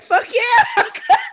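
loud, high, laughing shout; mock kid shout. Quoting the cussing girl, loud laughing shout. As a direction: shout it like a proud little girl. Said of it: "And she was like".

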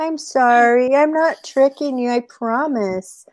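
put-on high-pitched child's promise; childlike apology. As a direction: apologise like a child who wants to be believed. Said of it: "when, like, you're a child".

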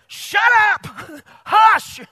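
high, loud shout of hush; angry shout. Acted. Jesus shouting the storm into silence, high and loud. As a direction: bellow it down with a laugh in the middle. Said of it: "And that means".

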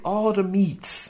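high, slow ad-slogan voice. Pretend. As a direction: drag it out like the commercial. Quoting Arby's slogan.

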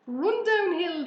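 high, loud drawn-out call; called-out suggestion. A narrator's reading. Cows advising the fleeing pig, loud call. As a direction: call slowly across the farmyard. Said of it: "suggested the cows".

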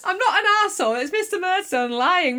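put-on high-pitched protesting boy; hammed-up plea to be believed; mock protest. Voices Davy protesting his innocence.

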